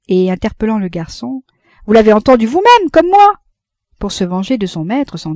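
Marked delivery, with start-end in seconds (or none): 1.8-3.3 loud, high appeal, rising at the end; insistent challenge. Acted. Café keeper challenges the waiter to back him, loud and insistent. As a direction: demand agreement from a witness.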